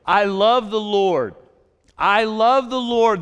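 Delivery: slow, loud psalm quote; preaching at full force. Acted. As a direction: proclaim it slowly to the congregation.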